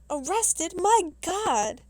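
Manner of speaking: loud, high-pitched shocked exclamation. A narrator's reading. Professor hearing his colleague was arrested, shocked. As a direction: exclaim in alarmed disbelief, voice jumping up.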